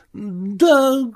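high-pitched Marvel, drawn out; hesitant Marvel. A narrator's reading. Marvel granting the sailor's point, hesitant and high.

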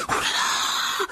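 put-on breathy, awestruck young boy; fascinated. A narrator's reading. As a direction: gasp it out in fascination. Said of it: "fasciné". (fasciné, le souffle coupé)

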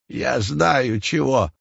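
put-on slow, creaky old man voice. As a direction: creak it out like a frail grandpa. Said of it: "проскрипел дедушка".

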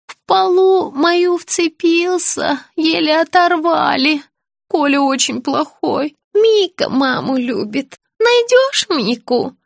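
put-on high, slow, childlike pleading; confused old woman. A narrator's reading. Sick woman begging to see her child, confused high voice. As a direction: plead slowly in a high little voice.

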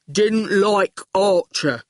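slow oaf stating simply; goon voice. A narrator's reading. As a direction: report it ploddingly like a simpleton. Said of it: "said the goon".

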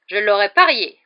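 loud, triumphant exclamation. A narrator's reading. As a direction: exclaim it loudly, sure you were right. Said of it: "s'exclama la tante Marge". (exclamation forte et satisfaite)

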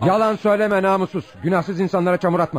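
high, outraged rebuke; defending the missing wife, high and outraged; angry accusation. Acted. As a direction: lash out at the liar. Tarık furious at Şevki's lies.

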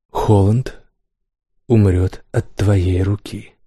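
slow, heavy royal pronouncement; king's verdict. A narrator's reading. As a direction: hand down the sentence slowly. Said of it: "Эти слова долго преследовали Келла".

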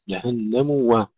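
جهنم و with a distinct mu before wa; clear damma. Shown as a demonstration. Teacher modelling the link, full damma in جهنم و. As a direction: sound the u in جهنم و. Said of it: "ضم الميم".

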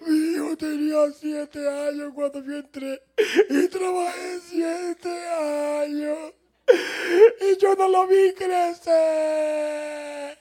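high, slow tearful whine that breaks into a wailing cry; hammed-up sobbing of a grieving father; weeping over lost years, overplayed; mock crying. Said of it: "el director de la cia llorando".

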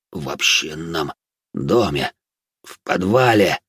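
low, slow, halting last words; weak, laboured confession with pauses; dying whisper. A narrator's reading. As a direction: gasp out the answer in broken pieces. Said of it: "прошептал легеец и умер".

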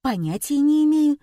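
high, artless reply; frank admission. A narrator's reading. Heroine answering the illusionist honestly, artless. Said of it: "честно ответила я".

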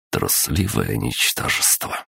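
low, slow contempt; contemptuous. A narrator's reading. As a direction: mutter it with disdain. Narrator's contemptuous inner thought.

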